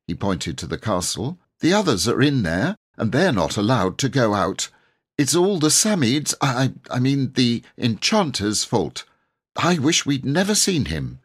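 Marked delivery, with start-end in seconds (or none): 1.6-11.2 lightly voiced boy rattling off his story; a young lad hurrying to explain, a touch higher; boy Robert, eager and breathless. A narrator's reading. Robert telling the knight his tale, eager boyish voice.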